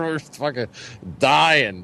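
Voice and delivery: usual speaking voice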